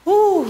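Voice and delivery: sudden high loud whoop; high excited whoop. Real, not acted. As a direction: let out a happy cheer. Cheer after finishing set.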